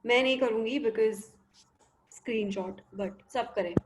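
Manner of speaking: ordinary talk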